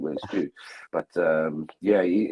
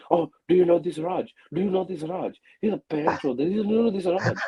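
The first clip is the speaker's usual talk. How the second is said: lightly voiced Raj, pitched up and sing-song; mock Raj, higher and teasing; a light take on Raj's way of talking; imitating Raj; 7 semitones higher than usual. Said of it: "he talks like this".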